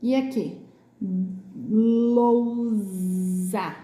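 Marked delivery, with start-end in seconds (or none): 1.7-3.8 lousa drawn out with long buzzing Z; stretched Z sound. Shown as a demonstration. Teacher showing another example, very slow lousa with Z. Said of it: "também com som de Z".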